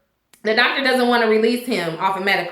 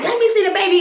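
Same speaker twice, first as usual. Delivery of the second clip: higher insistent demand; 8 semitones higher, faster than usual. Retold or imitated. Eddie pulling at Alex, insistently demanding to see the baby. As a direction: tug at her with the words. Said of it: "He's like".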